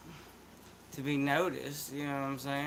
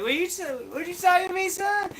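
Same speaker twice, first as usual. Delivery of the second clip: very high, loud rapid country jabber; hammed-up country-boy squawk; 6 dB louder than usual. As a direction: blurt it in a high-pitched loud yell. Mimics his drawl confusing Michiganders.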